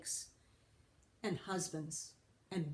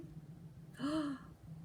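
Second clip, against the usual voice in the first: gasp; 7 semitones higher, 5 dB quieter than usual. Acted. Anthony sees the magic.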